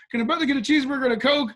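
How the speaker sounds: high, fast, insistent outburst; exasperated demand. Retold or imitated. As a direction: demand food urgently, pitched high. Repeats his earlier yelled demand.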